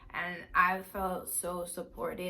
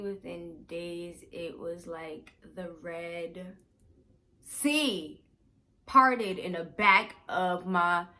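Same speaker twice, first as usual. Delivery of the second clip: dramatic slow; 6 dB louder, much slower than usual. Real, not acted.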